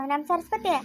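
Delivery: ordinary delivery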